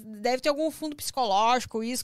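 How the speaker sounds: muffled behind a face mask; through a face mask. Real, not acted. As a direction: talk through a mask over the mouth. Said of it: "Vou tirar a máscara pra falar".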